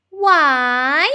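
put-on high, loud child's "Why?"; children's voice. A narrator's reading. Children asking their mum why, high and loud. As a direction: pipe up like curious children.

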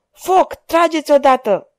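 high, loud order to fire; yelled command. A narrator's reading. Monclar to arquebusiers as the fugitives escape, yelling. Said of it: "urlă Monclar".

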